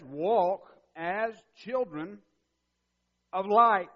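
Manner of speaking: booming slow exhortation; preaching loud. Real, not acted. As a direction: command it slowly. Emphatic quote of Paul.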